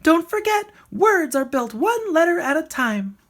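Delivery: high, loud bird call; calling back. A narrator's reading. The bird calling to Rocket as she flies, high and loud. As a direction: call it down from the sky. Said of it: "she called".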